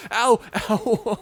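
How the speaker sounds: high, loud laugh breaking into ows; laughing yelps. Acted. Loud laughing ows as her partner retaliates. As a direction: laugh and cry ow at once. Laughing then yelping in pain.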